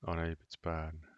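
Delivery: normal talking voice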